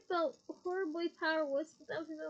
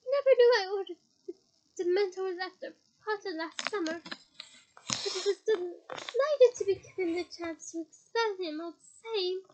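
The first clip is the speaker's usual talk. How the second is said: higher, louder gloating; gloating Umbridge; 4 semitones higher, 7 dB louder than usual. Acted. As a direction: savor the confession.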